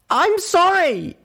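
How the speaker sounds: very high, loud, slow sobbing cry; tearful yell. Acted. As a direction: wail the apology through tears. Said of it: "I yelled back, bursting into tears".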